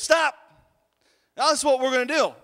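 loud, high teacher's order; commanding teacher. Retold or imitated. Teacher stopping children running in place, loud and commanding. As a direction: imitate a teacher halting the class. Voices teacher halting the class.

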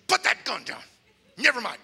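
loud, hard order; angry command. Retold or imitated. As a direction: make him drop it. Palaga orders gunman.

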